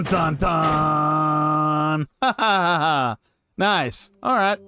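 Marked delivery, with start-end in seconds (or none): none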